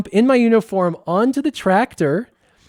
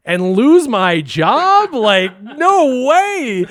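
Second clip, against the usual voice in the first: incredulous; 7 semitones higher, 5 dB louder, much slower than usual. Retold or imitated. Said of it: "And I'm like".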